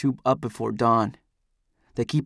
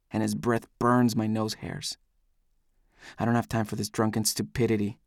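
ordinary delivery

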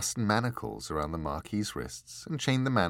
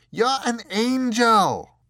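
much higher, louder, slow disbelieving cry; angry outburst; 10 dB louder, slower than usual. Acted. Richard to the angel, a high loud slow angry cry. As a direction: hurl the word angel at it in outrage.

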